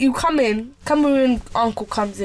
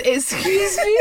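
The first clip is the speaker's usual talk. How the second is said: high "excuse me?" with a laugh; feigned not hearing; 6 semitones higher, slower than usual. Retold or imitated. Friend taking the piss at him, feigned not hearing. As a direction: act as if you didn't catch it. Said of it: "said as if she didn't hear him".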